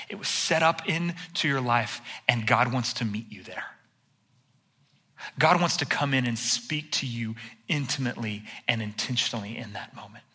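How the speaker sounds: soft, whispered invitation to God's nearness; whispered sermon. Real, not acted. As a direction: lean in and whisper it to the congregation. Preacher turns intimate, God's nearness.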